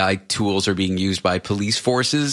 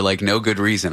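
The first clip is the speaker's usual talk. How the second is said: normal talking voice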